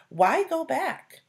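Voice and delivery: questioning ant. Acted. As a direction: pipe up as a small worried ant.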